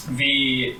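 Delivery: usual speaking voice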